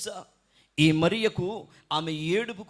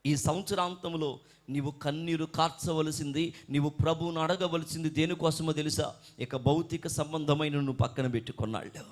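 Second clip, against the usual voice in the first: ordinary talk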